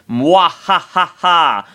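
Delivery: slow villain laugh, mwahaha; evil cackle. Pretend. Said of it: "I'm so evil".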